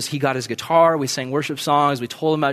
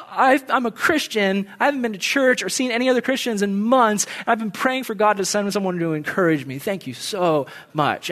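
imitated tearful gratitude; 6 semitones higher than usual. Crying store worker thanking a stranger, mildly imitated tearfulness. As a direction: lightly imitate someone overcome with thanks. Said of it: "just starts bawling".

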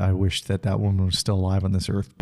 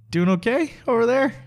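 teasing friend voice; 13 semitones higher than usual. Retold or imitated. Friend checking on him.

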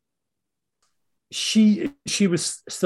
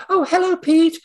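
imitated mom; 13 semitones higher than usual. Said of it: "it would be like anybody's mom".

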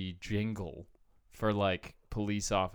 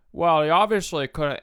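dumb cop voice; 6 semitones higher, 10 dB louder than usual. Retold or imitated. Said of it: "they're so dumb".

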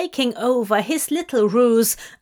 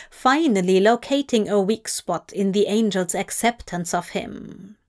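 plain reading voice

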